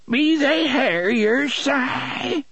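very high, slow, quavering woman's voice; cracked, quavering cry. A narrator's reading. Blind Mercy questions Si about the strangers, cracked quavering cry. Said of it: "she cried in a cracked, quavering voice".

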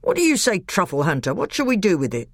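a brisk, no-nonsense dwarf talking sense; gruff red dwarf. Acted. Red dwarf refusing to kill the guest, brisk and blunt. Third voice scolds, then asks.

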